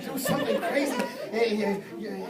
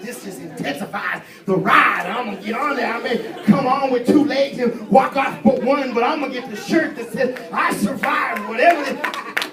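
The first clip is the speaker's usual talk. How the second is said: cocky bravado; 2 semitones higher, 8 dB louder than usual. Retold or imitated. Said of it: "I said".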